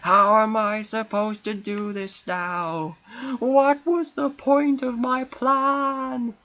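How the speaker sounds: high, slowing wail of a foiled schemer; villain whose plan just failed, high and slow; despairing villain. Acted. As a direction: cry out as the plan collapses, dragging the last line. Story villain's plan ruined.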